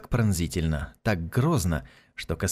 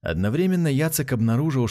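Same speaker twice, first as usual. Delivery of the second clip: usual speaking voice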